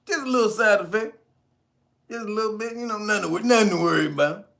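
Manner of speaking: high, sing-song fake reassurance; mock reassurance. Mock reassuring about side effects.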